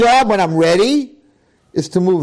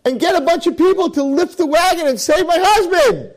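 much higher, desperate cries for help; frantic screaming lady. Retold or imitated. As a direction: plead frantically for her dying husband. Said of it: "a lady screaming".

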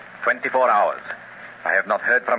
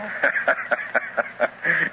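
evil chuckle; 8 semitones higher than usual. Acted. Villain mocks servant's fear.

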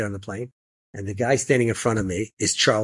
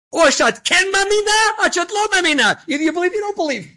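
yelling; 4 dB louder than usual. Retold or imitated. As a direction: bellow the line down the row at the lady, high and loud. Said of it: "is yelling at the lady in the middle seat".

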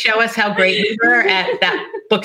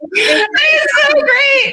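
high squeal through laughter; laughing; 3 dB louder, much slower than usual. Real, not acted. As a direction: gasp it out while cracking up. Laughing at the story.

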